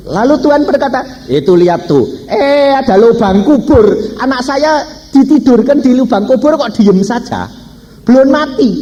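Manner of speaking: high, forceful retelling of God's words; impassioned preaching. Retold or imitated. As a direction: proclaim it urgently, pitched well up. Preacher voices God's urgent words.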